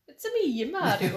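hammed-up Mario Italian accent; cheesy Italian accent, playful.

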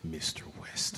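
hushed mocking "Mister West"; mocking whisper. Shown as a demonstration. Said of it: "he's still a mister regardless".

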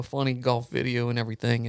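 ordinary delivery, nothing marked

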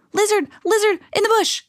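high, quick excited call; high-pitched breathless summons; urgent calling. A narrator's reading. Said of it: "he called quickly".

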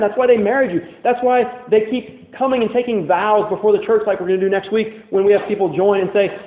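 ordinary delivery

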